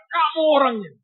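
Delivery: high, loud shout. Retold or imitated. Old man shouting at the young preacher, loud. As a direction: cry it out at him. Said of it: "dia teriak".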